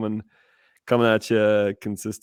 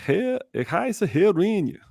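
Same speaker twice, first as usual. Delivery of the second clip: slow, high, hammed-up French accent in German; a Frenchman introducing himself in German, drawn out; theatrical French accent in German; 11 semitones higher than usual. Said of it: "French accent in German".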